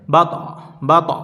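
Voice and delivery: ত of "বা ত" bounced with a small spring and drop; an echoing bounce on the ত of "বা ত"; qalqala bounce. Shown as a demonstration. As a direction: let the ত jolt and drop like a push. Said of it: "একটু spring করবে, ধাক্কার মতন, একটু drop খাবে".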